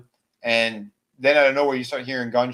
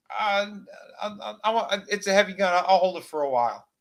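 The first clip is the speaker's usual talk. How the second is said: higher, hesitant, half-innocent voice; nervous Russell; 8 semitones higher than usual. Retold or imitated. Deputy stalling as he keeps the rifle, higher nervous voice. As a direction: stammer like you're hiding something.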